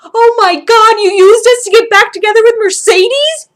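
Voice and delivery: shrieked high and loud in shock; exclaiming. A narrator's reading. Blaine shrieking in disbelief at Sam, loud and high. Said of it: "Blaine exclaims".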